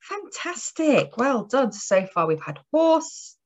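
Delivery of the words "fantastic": ordinary talk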